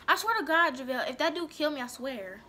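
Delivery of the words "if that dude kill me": normal talking voice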